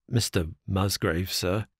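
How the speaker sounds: low, soft, pleading butler; a broken servant's hoarse plea; hoarse with emotion. A narrator's reading. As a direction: choke the words out, hoarse and begging. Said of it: "in a voice which was hoarse with emotion".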